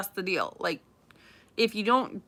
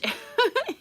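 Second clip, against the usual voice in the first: high burst of laughter. Real, not acted. Laughing at a bystander's interruption.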